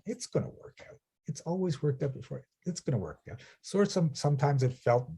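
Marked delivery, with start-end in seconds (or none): 0.1-3.4 softer, whispered matter-of-fact reassurance. Retold or imitated. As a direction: say it as simple fact, softly. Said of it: "matter of fact".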